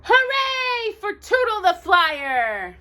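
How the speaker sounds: high, slow, drawn-out cheer; crowd cheer. Acted. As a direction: hail the hero. Villagers cheering Tootle.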